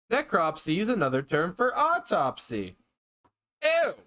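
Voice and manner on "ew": high, loud grossed-out ew; disgusted ew. Real, not acted. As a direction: recoil in disgust. Disgusted by autopsy fact.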